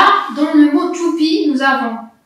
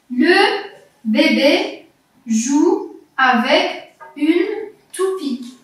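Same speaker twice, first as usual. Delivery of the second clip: slow, word-by-word reading; careful model reading; slower than usual. Shown as a demonstration. Teacher reads a sentence for the child to listen, slow and separated. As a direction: leave a clear gap after each word. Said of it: "Je vais maintenant lire une phrase".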